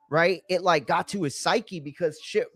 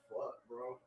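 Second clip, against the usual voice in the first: stunned mutter; 5 semitones lower, 22 dB quieter than usual. Retold or imitated. Own stunned reaction retold.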